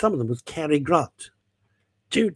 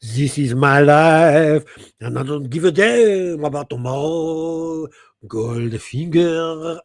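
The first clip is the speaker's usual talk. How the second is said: slow, belted diva croon; drawn-out showbiz diva singing her line; 6 dB louder, slower than usual. Retold or imitated. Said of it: "[name] as Dame [name]".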